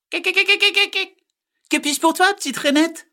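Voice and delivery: very high, loud staccato cackle; chattering magpie. Acted. Magpie greets little frog, shrieky chattering bird voice. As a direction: stutter rapidly like a magpie.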